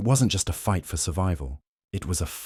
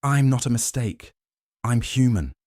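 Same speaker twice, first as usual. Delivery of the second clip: higher, pushing the defiance out; roaring defiance; 6 semitones higher, 3 dB louder than usual. Acted. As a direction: roar it back at him. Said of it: "Ethan roared".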